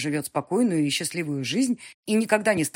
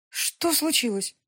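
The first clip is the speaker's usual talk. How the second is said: higher, slow alarmed question; worried mother; 11 semitones higher, slower than usual. Acted. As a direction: ask slowly, frightened for her.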